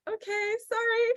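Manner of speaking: high, small sheepish apology; meek mock apology. To the coach who scolded her, high sheepish apology. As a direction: shrink back and apologize. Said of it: "And I was like".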